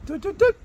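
high sung doo doo doo; imitated dishwasher beep. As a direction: chirp it high like a dishwasher jingle. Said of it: "it goes".

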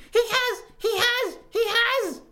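very high, loud squeak; a tiny creature squealing it out; squeaking baby squirrel. A narrator's reading. Baby squirrel defies the witch, squeaking at the top of its voice. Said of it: "it squeaked".

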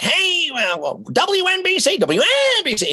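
put-on high-pitched old-time radio DJ patter; Howard Stern. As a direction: imitate an overeager young shock jock, way up high. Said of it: "like, Howard Stern thirty years ago".

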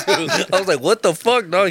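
high, laughing mock anger; laughing outrage. Man laughing at Sean over the job, laughing mock anger. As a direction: yell at him through laughter.

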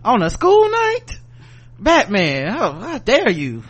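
high-pitched, drawn-out mock outrage; mock indignation. Reluctant Robin refusing Batman on a school night, mock-indignant. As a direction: scold with exaggerated, affronted disbelief.

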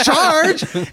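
high, puzzled question; baffled, as if hearing it first time; confused "Charge?". Retold or imitated. Retelling the confused reply.

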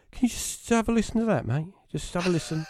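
high, softened retold plea; mock-shaken urging, pitched well up; spooked pleading. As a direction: plead with your mate, high and hushed. Spooked, asks mate to listen.

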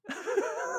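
sudden high-pitched laugh. Real, not acted. Podcaster cracking up at the insult, high laugh.

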